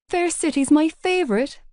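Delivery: light up about a favourite thing; a touch of fangirl enthusiasm, lifted high; mild gushing. Acted. Girl gushing about favourite soap.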